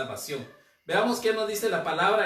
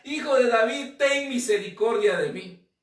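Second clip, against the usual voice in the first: raised, louder pleading cry; a blind beggar crying out to Jesus; 6 semitones higher, 6 dB louder than usual. Acted. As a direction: cry out even harder. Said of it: "él clamaba mucho más".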